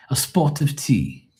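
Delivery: drawn-out mock British aside. Reprises the British impression.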